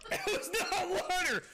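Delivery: high-pitched shocked cry; shocked scream. Real, not acted. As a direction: cry out after a nasty surprise drink. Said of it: "That scream".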